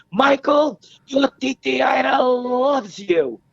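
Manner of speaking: high, slow and tender, calling "Michael" out with love; loving message. Retold or imitated. Priestess passes on aunt's message.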